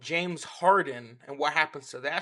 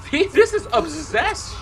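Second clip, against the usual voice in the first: laughing disbelief; 9 semitones higher, 8 dB louder, slower than usual. Real, not acted. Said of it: "reacting to disturbing video".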